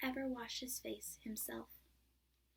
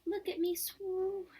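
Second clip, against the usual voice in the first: much higher, louder little-kangaroo squeak; squeaky Roo; 9 semitones higher, 7 dB louder, slower than usual. A narrator's reading. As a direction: squeak it out proudly. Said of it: "squeaked Roo".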